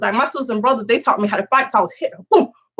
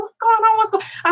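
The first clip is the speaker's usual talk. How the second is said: alarmed sister; 11 semitones higher than usual. Retold or imitated. Sister rushing out.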